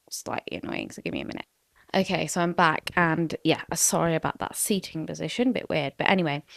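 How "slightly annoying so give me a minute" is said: soft, voiceless mutter; whispering. Real, not acted. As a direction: whisper it aside. Muttered aside while leaving.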